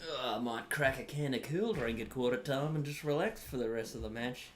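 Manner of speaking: lightly voiced relaxed bogan; a hint of a lazy Aussie boomer; bogan baby boomer. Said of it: "Australian bogan baby boomer".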